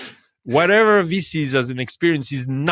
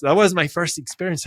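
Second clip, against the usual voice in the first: ordinary talk, nothing marked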